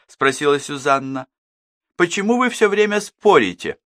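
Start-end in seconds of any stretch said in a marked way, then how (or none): 2.0-3.8 plaintive outcry. Acted. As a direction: complain to the quarrelling sisters. Susanna upset by quarrel. (высокий жалобный возглас)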